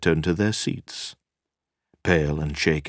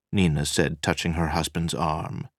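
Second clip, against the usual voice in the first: ordinary talk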